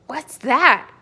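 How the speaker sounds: loud, high startled cry; startled shout. Acted. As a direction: jump up and shout it. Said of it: "cried the giant".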